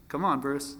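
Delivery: usual speaking voice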